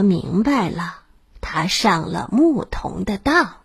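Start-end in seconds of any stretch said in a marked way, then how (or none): none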